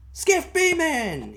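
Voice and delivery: very high, loud, slow call across the water; a drawn-out loud shout to startle him awake. Retold or imitated. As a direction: hail the boy from the rowboat. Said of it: "loud enough to jog me".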